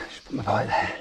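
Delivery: a quick murmured aside; whispered aside. Real, not acted. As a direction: whisper it under your breath. Aside while parking the bike.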